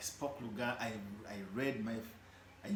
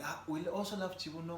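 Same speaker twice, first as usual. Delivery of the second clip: fans quoted; 8 semitones higher, 4 dB louder than usual. Retold or imitated. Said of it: "they like".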